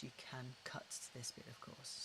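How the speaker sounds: ordinary delivery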